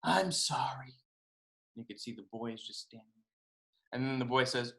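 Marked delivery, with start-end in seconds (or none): 0.0-0.9 soft, whispered apology; hushed, sorry murmur; feeling bad. A narrator's reading. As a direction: whisper it, ashamed. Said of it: "pretty bad about what he did".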